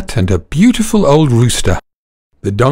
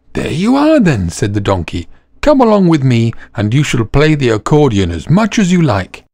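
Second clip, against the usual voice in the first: delighted donkey; 3 semitones higher than usual. Acted. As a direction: welcome him warmly aboard. Donkey encourages dog.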